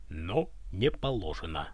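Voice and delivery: low, soft, slow confidential voice; deep hushed whisper. A narrator's reading. Guard admits a secret to the tsar, deep hushed whisper. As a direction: drop low and hush it, slowly. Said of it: "понизив голос до басовитого шёпота".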